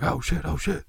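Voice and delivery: high-pitched panicked hippie; high, alarmed imitation; mock panic. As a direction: play a hippie caught in a raid. Acts out panicked raided hippies.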